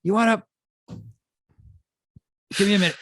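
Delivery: high, lightly voiced lecherous priest; half-hearted priest in ecstasy; priest impression. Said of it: "Is your impression of a, a priest?".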